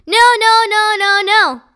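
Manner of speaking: loud, high, insistent "no"s; eager shouting. A narrator's reading. Judy calls out to Mister Todd, loud and high. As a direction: blurt it out over and over.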